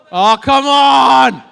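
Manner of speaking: loud, drawn-out "come on" in protest; exasperated shout. Real, not acted. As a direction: shout it long and high at the crowd. Mock outrage at the crowd.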